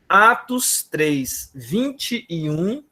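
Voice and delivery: Atos três, vinte e um said very slowly, word by word; slow dictation. Shown as a demonstration. Pastor repeats the verse slowly so the church finds it. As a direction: spell out the reference slowly for them to find. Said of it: "Alguém abra pra nós aí".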